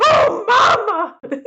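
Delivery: high shouted cartoon plumber cry; Mario "oh mama" cry. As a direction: yell it like Mario getting hit by fire. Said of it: "where he's like".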